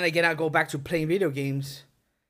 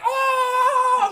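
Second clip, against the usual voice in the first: high, loud reenacted scream; freaked-out yell; 6 dB louder than usual. Storyteller relives seeing the window move, loud frightened yell. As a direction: cry out as if scared by a horror movie. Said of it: "freaked the fuck out".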